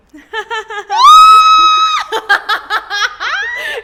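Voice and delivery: loud high manic cackle; creepy sleep laugh. Retold or imitated. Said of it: "you hear".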